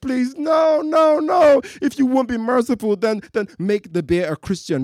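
high, loud frantic pleading; desperate shouting. Acted. Atheist to God, frantic loud pleading. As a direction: shout your last bargain at God.

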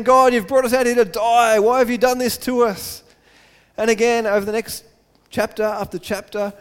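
full-force preaching. Acted. Preacher retelling the people's complaints, loud and forceful. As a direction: preach it hard to the congregation.

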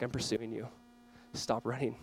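very low, very soft command; quiet, intimate voice of God; hushed appeal. Acted. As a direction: say it almost under your breath, tenderly firm.

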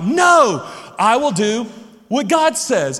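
high, booming mock declaration; real loud. Dad keeping his kids involved, reading out loudly. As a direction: shout it out for the kids. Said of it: "real loud".

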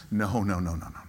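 usual speaking voice

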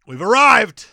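loud triumphant cry; excited. Acted. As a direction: announce it like a victory. Celebrating reaching one hundred percent.

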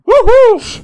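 loud, high woohoo; victory whoop. Pretend. Host winning a small argument, high triumphant whoop. Celebrates winning pronunciation bet.

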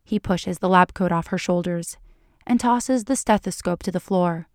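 ordinary delivery, nothing marked

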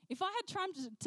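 ordinary talk

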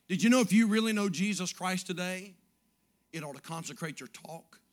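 usual speaking voice

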